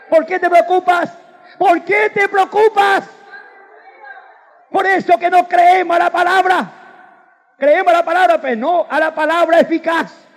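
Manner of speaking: high, loud fervent preaching; full-force preaching. Acted. Preacher shouting praise at the congregation. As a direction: preach it out with fire.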